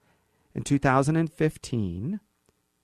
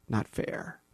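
"Not fair." breathed, soft and mocking; scornful whispered "Not fair."; whispered echo; 4 semitones lower, 9 dB quieter than usual. Shown as a demonstration. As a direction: repeat "Not fair." under your breath. Mocking echo of quoted phrase.

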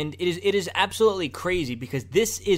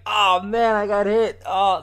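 high, loud hammed-up cop whine; mock injured officer, overdone; 5 semitones higher, 6 dB louder, slower than usual. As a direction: ham it up like a faking cop. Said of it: "he looked like he's kinda smiling".

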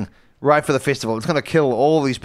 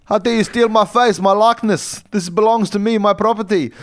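much higher, louder outraged tycoon; indignant Elon Musk; 8 semitones higher, 4 dB louder than usual. Retold or imitated. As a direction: squeal with offended pride over his face. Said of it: "It's like".

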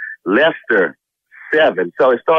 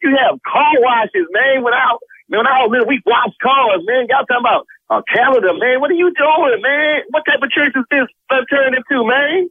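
outraged; 7 semitones higher than usual. Real, not acted. Husband outraged at church calendar.

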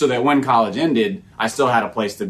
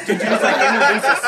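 laughing; 10 semitones higher, 5 dB louder than usual. Real, not acted.

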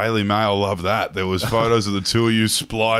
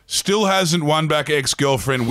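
mock headline; 5 semitones higher than usual.

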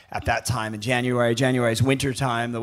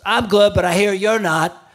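higher, louder deadpan dig from the father; dry dad comeback; 7 semitones higher, 6 dB louder than usual. Retold or imitated. Dad greeting the rival with a cutting, raised retort. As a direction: deliver the put-down with dry bite. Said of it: "my dad goes".

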